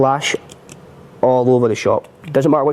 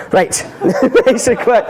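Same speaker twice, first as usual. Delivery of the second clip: laughing; 10 semitones higher, slower than usual. Real, not acted. Laughing at his own quick joke to viewers. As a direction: crack up mid-line.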